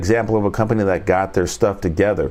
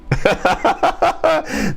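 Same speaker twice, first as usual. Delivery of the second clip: high laugh. Real, not acted. Laughs at own bit.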